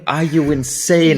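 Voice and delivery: incredulous. Real, not acted. Shocked he listened while driving.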